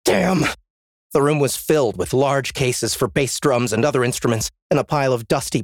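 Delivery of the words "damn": frustrated curse. Acted. Door locked.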